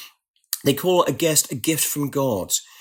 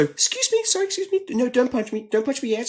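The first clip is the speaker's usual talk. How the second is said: apologetic pushing through; 11 semitones higher than usual. Retold or imitated. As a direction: start high and timid, ease down. Pushing through angry mob.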